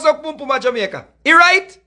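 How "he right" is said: high, loud sarcastic he right; sarcastic jab; incredulous mock question. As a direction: needle them with a disbelieving rise. Mock rhetorical question repeated.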